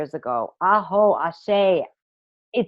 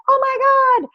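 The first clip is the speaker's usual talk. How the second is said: hammed-up panic; 3 dB louder than usual. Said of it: "run back to the group and say, like".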